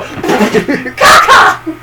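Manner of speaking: high, voiceless squawked cry; mock squawk. Imitating the lady from the movie for friends, mock squawk. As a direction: squawk it through laughter.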